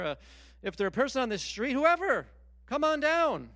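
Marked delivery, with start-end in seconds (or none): 1.7-3.5 excited invitation. Acted. Voicing God's open invitation.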